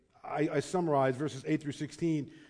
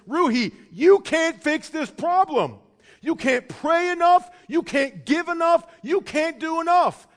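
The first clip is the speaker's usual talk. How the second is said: insistent plea; 9 semitones higher, 9 dB louder than usual. Retold or imitated. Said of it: "I would say to Ruhi".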